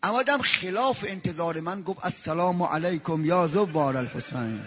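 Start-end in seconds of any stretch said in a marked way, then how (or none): 2.0-4.6 soft, low, reverent; softened, moved tone; tender greeting. Acted. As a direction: greet the pilgrims gently.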